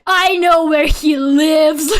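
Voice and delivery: loud, slow, hammed-up threat; joking rage at dad, loud and slow; mock fury. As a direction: stretch it out like a playful menace. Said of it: "And I got so mad".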